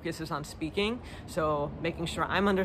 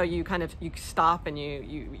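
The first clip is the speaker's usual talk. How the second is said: usual speaking voice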